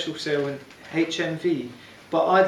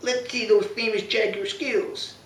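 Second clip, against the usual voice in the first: high, slow mocking Brooklyn thug; Brooklyn accent; 7 semitones higher, slower than usual. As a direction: taunt him in a Brooklyn accent. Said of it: "And he says".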